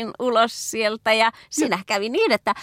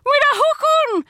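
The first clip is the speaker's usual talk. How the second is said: higher, louder, slow wailing cry; anguished cry; 4 dB louder, slower than usual. Acted. Isaiah cries out before the Lord in a desperate wail. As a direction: cry out as if you are going under. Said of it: "hän huutaa siinä Herran edessä".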